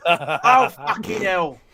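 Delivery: put-on half-hearted southern accent, trying again; a southern accent, tried half-heartedly. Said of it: "It's best I can do".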